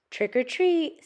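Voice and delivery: high, lilting doorstep call; trick-or-treat call. Acted. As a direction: call it like an eager kid. Children call out at the door.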